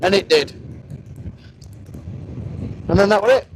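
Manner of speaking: ordinary talk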